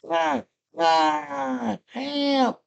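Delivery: very high-pitched mock scream for help; thin high cries for help. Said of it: "So she starts screaming".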